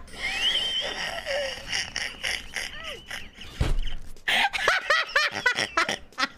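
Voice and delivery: long, high, breaking laugh; manic laugh. Real, not acted. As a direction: laugh helplessly until it runs out. Losing it after failing.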